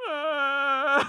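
high, loud whimpering ah; a wailing ah, half-laughing at the mess; high mock cry. I'm like.

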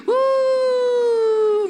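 loud, high celebratory whoop; joyful whoop. Acted. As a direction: let out a gleeful high woo. Celebrating grace arriving.

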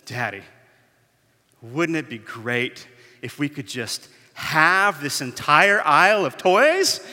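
lightly voiced dreamy child, slow and rising; wistful little girl, drawn out; a hint of a sparkly-eyed five-year-old. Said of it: "with kind of a sparkle".

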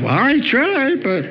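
high, exasperated little shrug of a voice; wife defending herself to her dozing husband; wife's reply. Pretend. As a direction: answer as the long-suffering wife. Wife answers.

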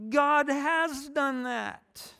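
high, slow, deliberate insistence; a pointed, drawn-out answer; emphatic retort. Real, not acted. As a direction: land each word slowly and firmly. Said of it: "To which I kinda want to say".

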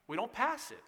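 ordinary delivery

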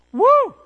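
high loud whoop of joy; excited whoop. Real, not acted. Preacher whooping at God's endless love, excited. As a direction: let out a joyful woo.

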